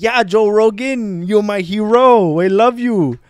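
high, loud, drawn-out French fan; gushing French accent. Retold or imitated. French fan's friend joins in adoring Joe Rogan, gushing French accent. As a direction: echo the adoration in a mock French accent. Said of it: "The accent alone is pretty fun".

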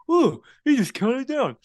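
high-pitched mock surprise; exaggerated "oh, I get it" voice; mock realization. Mocking contestants noticing the countdown.

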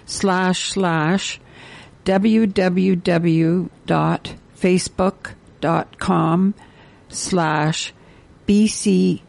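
slow, separated chunks with pauses; very slow dictation. Real, not acted. Radio host giving listeners a web address to copy, very slowly. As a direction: dictate it piece by piece. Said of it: "gonna say this very slowly".

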